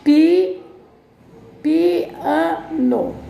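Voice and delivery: Pi- split off before piano, loud; syllable emphasis. Shown as a demonstration. Teacher to class, marking the syllables of piano. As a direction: break Pi- off then say piano. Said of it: "plano não".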